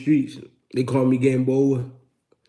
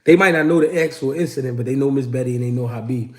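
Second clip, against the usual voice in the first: usual speaking voice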